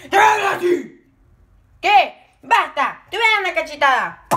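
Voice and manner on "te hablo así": mock quarrel. Acted quarrel.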